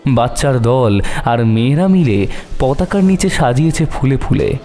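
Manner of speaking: usual speaking voice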